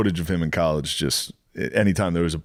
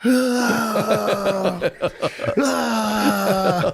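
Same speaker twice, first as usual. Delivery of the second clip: high, mock crying child; toddler wail; 12 semitones higher than usual. Toddler being told to sit, high mock wail. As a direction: wail like a kid put in timeout. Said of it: "They're like".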